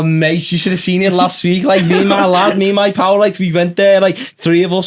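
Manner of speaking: thick put-on Scouse lad, chatty; Scouse accent. Lad telling his pal about last week, in a put-on Scouse accent. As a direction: play a Liverpool lad gossiping about his mate. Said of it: "sing in the Scouse accent".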